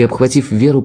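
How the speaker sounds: ordinary delivery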